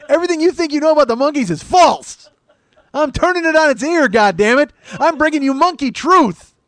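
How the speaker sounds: shouted mock proclamation, laughing through it; hammed-up rant. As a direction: bellow it like a carnival barker with a grin. Mock rant debunking Monkees myths.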